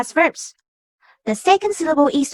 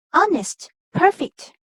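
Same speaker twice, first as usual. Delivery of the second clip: "honest, perfect", each higher on the first syllable; first-syllable stress; 4 semitones higher, slower than usual. Shown as a demonstration. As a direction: hit HON in "honest", PER in "perfect". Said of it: "the first syllable is stressed".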